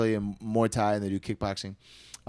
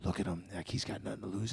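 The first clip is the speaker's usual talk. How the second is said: much softer, talking to yourself; quiet realization; softer muttered observation; 3 semitones lower, 14 dB quieter than usual. Retold or imitated. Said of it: "I was like".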